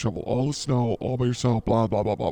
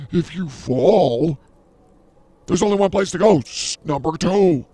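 mock drama; 4 semitones higher, 8 dB louder than usual. Dramatic fall from number one.

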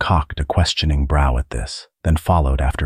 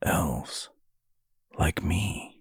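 very soft and faraway; hushed, withdrawn character voice; distant voice; 10 dB quieter, much slower than usual. A narrator's reading. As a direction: murmur it as if lost in thought. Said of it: "he said in a distant voice".

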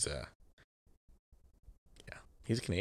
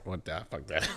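usual speaking voice

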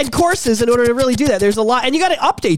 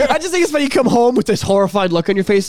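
usual speaking voice

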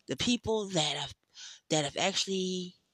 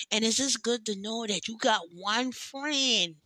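ordinary talk, nothing marked

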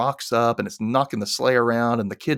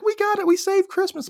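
very high, gleeful cheer; Percy cheering after the escape, far higher; triumphant kid. Retold or imitated. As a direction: celebrate too early like a kid. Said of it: "Percy is like".

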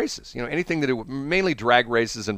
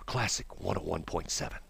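classical radio host; 4 semitones lower, 9 dB quieter than usual. Acted. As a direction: speak softly and unhurriedly, like classical radio. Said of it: "then you turn to a classical station".

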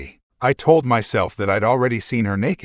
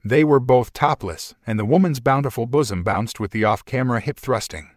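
ordinary delivery

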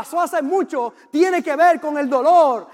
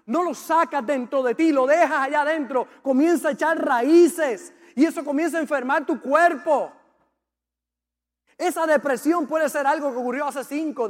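usual speaking voice

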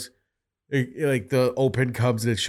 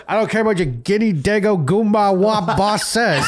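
laughing through a quote; 8 semitones higher than usual. Retold or imitated. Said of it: "when Tom Hagen goes".

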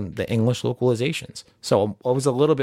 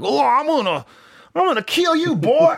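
hammed-up southern accent, pitched way up; 4 dB louder than usual. Android thirteen threatens to kill, hammed-up high southern accent. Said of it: "like, a southern accent".

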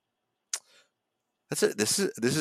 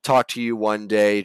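ordinary talk, nothing marked

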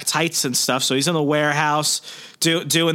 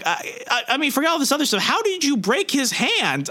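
much higher, stammered mock disbelief, slowing at the end; flustered Aunt May; 13 semitones higher than usual. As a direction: sputter like a baffled aunt. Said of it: "May is just like".

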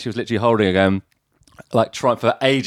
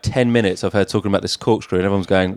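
ordinary delivery

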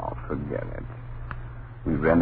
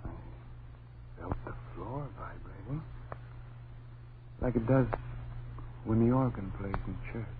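hushed; 3 semitones lower, 12 dB quieter than usual. Acted. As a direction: speak low so nothing hears you. Husband murmurs after the music stops.

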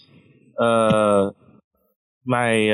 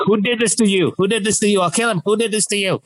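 much higher, frantic protective demand; furious uncle; 10 semitones higher, faster than usual. Retold or imitated. Uncle at the hospital bed demanding who hurt him, frantic. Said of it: "But today he was like".

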